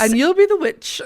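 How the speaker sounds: high, loud put-on voice; hammed-up casting call; mock casting verdict. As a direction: hand out the role in a high mock announcement.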